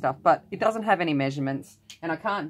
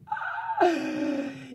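high giggle; 11 semitones higher than usual. Real, not acted. Excited at Aldi find.

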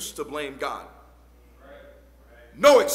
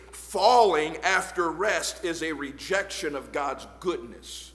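ordinary delivery

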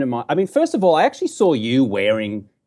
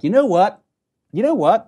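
high, exaggerated American catchphrase; mock American accent; 9 semitones higher than usual. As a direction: poke fun at Americans with a raised pitch. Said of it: "We say these phraseology like".

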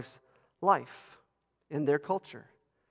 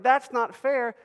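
high, louder objection in the audience's voice; mock protest; 10 semitones higher, 9 dB louder, slower than usual. Preacher voices children's ministry objectors, mock protest. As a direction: raise a playful complaint, loud and high.

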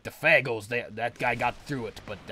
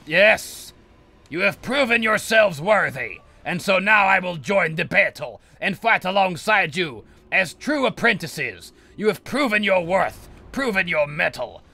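loud, high, grand proclamation; sorcerer's rousing battle speech; triumphant sorcerer; 8 semitones higher, 11 dB louder than usual. Pretend. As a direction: declare them worthy like a lofty master. Sorcerer proclaims apprentices worthy.

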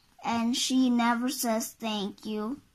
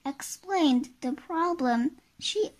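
ordinary delivery

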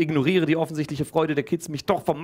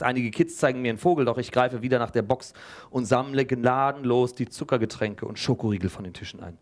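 ordinary delivery

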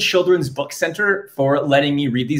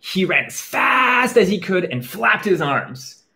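plain reading voice

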